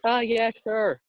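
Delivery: high, girly Irish impression of the player; mock Irish accent. Pushed by a friend to do the player's Irish accent, high mock Irish. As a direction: squeak it out in a hammed-up Irish lilt. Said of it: "accent, accent, accent".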